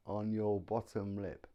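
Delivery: ordinary talk, nothing marked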